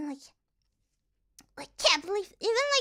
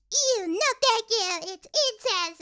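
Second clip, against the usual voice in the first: drawn-out, higher, louder mock revulsion; mock disgust; 4 semitones higher, 5 dB louder than usual. Imitating online critics of an incest anime, mock disgust. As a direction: imitate a disgusted objector, laying it on thick.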